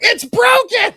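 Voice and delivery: high-pitched mock wail, shouted; hammed-up panicked yell; upset homeowner. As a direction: shriek it in exaggerated outrage. Acting out upset homeowners' outcry.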